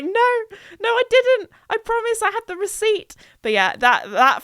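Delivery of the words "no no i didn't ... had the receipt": high, desperate protesting; panicked denial. Pretend. To the police at her door, high panicked denial. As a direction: squeal it out like you've been caught.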